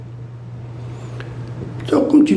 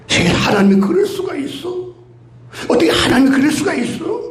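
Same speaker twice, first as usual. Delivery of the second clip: higher, fast, outraged disbelief; mock indignation; 6 semitones higher, faster than usual. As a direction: scoff in mock outrage at God. Acted indignant complaint.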